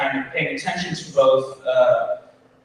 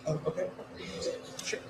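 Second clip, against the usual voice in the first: ordinary talk, nothing marked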